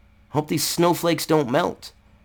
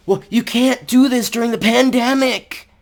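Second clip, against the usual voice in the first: mocking whiny opponent; 8 semitones higher than usual. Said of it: "I know they're gonna say".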